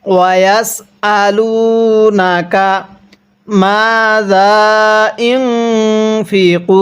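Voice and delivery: "ইউনফিকুন" slowly, with the n hummed through the nose; nasal hum on the n of "ইউনফিকুন"; nasalized n. Shown as a demonstration. Teacher shows ghunnah on the noon sakin. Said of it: "এই নুন সাকিনটা আমরা গুনাহ করব".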